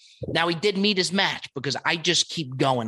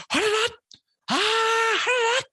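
imitated yell. Sushi chef yelling at the diner, very high imitated yell. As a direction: mimic the chef's yell way up high. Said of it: "and he just yells".

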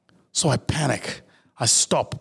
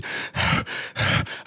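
a slow breath out to steady himself; calming exhale. Acted. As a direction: exhale to calm the panic. Trying to calm himself.

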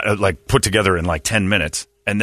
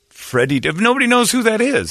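ordinary talk, nothing marked

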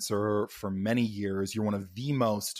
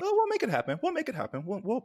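squealing excited; 14 semitones higher, faster than usual. Real, not acted. Squealing enthusiasm.

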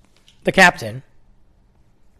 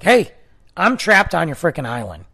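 exasperated retold call; 4 semitones higher, 6 dB louder than usual. Confronting the island police guy.

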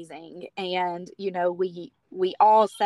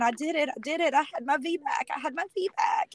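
retold overjoyed cry, pitched high; imitating a happy, tearful outburst; tearful triumph; 7 semitones higher, faster than usual. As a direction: climb higher with each repeat, reliving tearful joy. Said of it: "I just cried the biggest".

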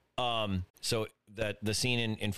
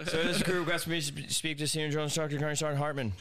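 recruit shouting request higher; barked sir yes sir; 7 semitones higher, faster than usual. Retold or imitated. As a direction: bark it out like a recruit.

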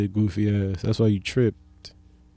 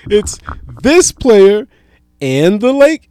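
announcer quote; 8 semitones higher, 7 dB louder, slower than usual. Retold or imitated. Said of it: "they go".

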